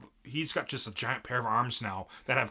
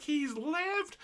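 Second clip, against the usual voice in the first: shocked. Retold or imitated. Villain sees hero alive.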